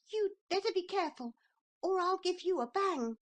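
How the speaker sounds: put-on high, fast boyish threat; indignant little boy. A narrator's reading. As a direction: puff up like an offended child. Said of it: "He hated to be called a cowardly custard".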